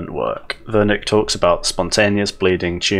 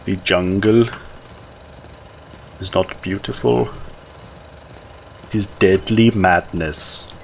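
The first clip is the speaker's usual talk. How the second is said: Herzog impression. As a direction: brood like Herzog in a German accent. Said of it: "I can't do his accent".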